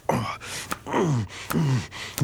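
ordinary talk, nothing marked

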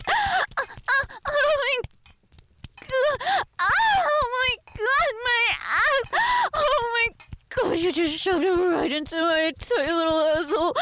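high, straining cries of shock; acted shocked cry. Waitress crying out at an unexpected shove. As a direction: yelp out the surprise and pain.

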